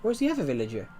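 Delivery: sudden high-pitched disbelief; shocked squeak. Real, not acted. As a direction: yelp it in sheer surprise. Sees villager floating, shocked.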